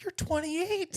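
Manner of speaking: high, incredulous squeak; mock shock at an adult who never flew; disbelief. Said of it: "I was like".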